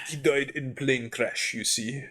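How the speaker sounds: slow, put-on Russian accent; deadpan it in a slow fake Russian accent; mock Russian accent. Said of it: "I was trying to talk Russian".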